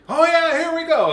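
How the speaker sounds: loud, high excited cheer. Retold or imitated. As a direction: cheer as things swing your way. World swinging one way.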